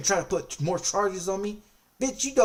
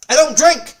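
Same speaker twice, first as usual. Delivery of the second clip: louder, higher, hammering the point; shouted denial; 8 semitones higher, 11 dB louder than usual. Retold or imitated. To the officer who added charges, an angry shout. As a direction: bark it right at him.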